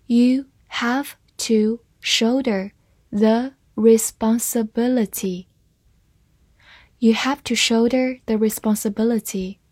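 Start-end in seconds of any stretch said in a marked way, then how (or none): 0.1-5.5 slowly, word by word, with gaps between; measured, careful model reading for learners. Shown as a demonstration. Teacher models the sentence for learners to follow, slow reading. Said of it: "慢慢来读".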